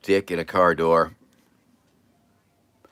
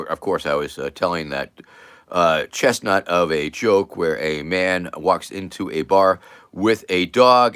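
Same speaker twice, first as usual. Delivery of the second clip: ordinary talk, nothing marked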